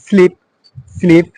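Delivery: "স্লিপ" with an ee vowel; double e as ee. Shown as a demonstration. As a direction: model the double e in "স্লিপ" as an ee sound. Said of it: "ই এর মতো".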